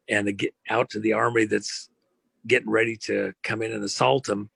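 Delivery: ordinary talk, nothing marked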